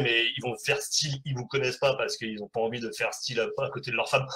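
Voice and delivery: ordinary talk, nothing marked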